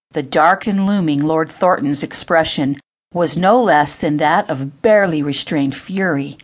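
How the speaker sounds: ordinary delivery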